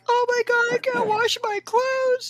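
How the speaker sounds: imitating her own sobbing over the broken dryer; mock crying. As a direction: wail it like you're sobbing. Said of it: "I was crying like".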